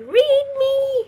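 high, loud voice of a pleading book; playful high-pitched book voice; talking book. Pretend. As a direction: call out high like a book begging for attention. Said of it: "to remind me".

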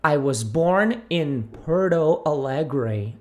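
slow, Americanised "I was born in Porto Alegre."; "Porto" said "podo", as an American would; model sentence. Shown as a demonstration. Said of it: "no meu caso". ("Porto Alegre" com sotaque americano)

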